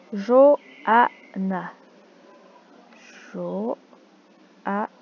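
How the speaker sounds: "Johanna" said very slowly, syllable by syllable; counting syllables. Shown as a demonstration. Teacher counting the syllables of a pupil's name. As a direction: stretch "Johanna" to count its beats. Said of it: "Trois syllabes".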